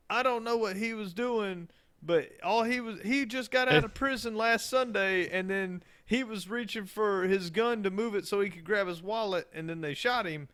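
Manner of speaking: high-pitched imitation of a street accent; exaggerated inner-city accent.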